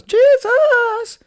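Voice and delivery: very high, loud wailed Jesus; mock wail. Host wailing for Jesus in mock horror, very high.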